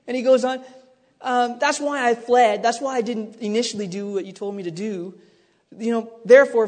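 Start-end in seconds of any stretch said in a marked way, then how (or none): 1.6-6.7 whiny Jonah. Acted. Voicing Jonah's whiny prayer.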